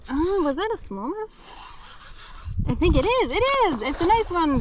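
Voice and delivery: high, loud excited cries; thrilled at a big catch. Real, not acted.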